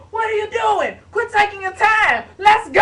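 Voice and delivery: high, loud impatient yelling; panicked shouting. Retold or imitated. Brother shouting at his sister to stop taking her time, high loud panic. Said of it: "my brother was screaming".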